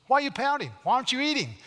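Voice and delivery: wife scolding her pouting husband, high and loud; nagging queen. Acted. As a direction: put on a shrill-edged nagging wife demanding answers. Preacher voices Jezebel nagging the king.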